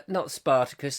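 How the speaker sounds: ordinary delivery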